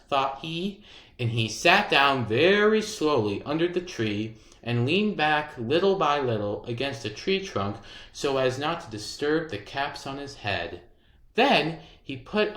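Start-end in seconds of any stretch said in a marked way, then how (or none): none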